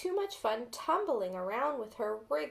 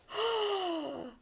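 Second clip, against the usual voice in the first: sharp, higher gasp of surprise; surprised gasp; 6 semitones higher than usual. A narrator's reading. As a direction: gasp as the first head pops up. The egg suddenly hatches.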